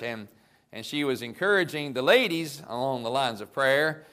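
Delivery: normal talking voice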